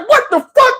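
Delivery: high, startled disbelief; shocked outburst. Retold or imitated. Telling the crowd how he reacted to her: stunned high yelp. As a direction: yelp it out in shock, pitch jumping up. Shocked when she grabs him.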